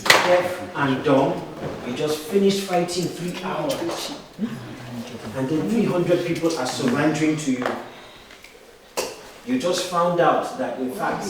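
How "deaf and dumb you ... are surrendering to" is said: slow hushed scene-setting; slow, silent emotion. Shown as a demonstration. Coach slowly setting up a silent emotion scene. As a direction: let each word sink in slowly. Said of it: "silent emotion exercise".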